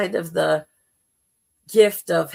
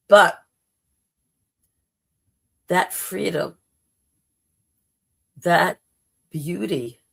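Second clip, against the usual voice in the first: normal talking voice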